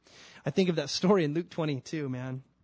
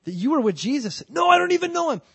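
desperate denial; 11 semitones higher, 7 dB louder than usual. Acted. Accuser then Peter's denial.

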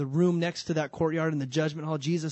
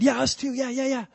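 eager agreement; 8 semitones higher, 4 dB louder than usual. Acted. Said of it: "all the other guys said".

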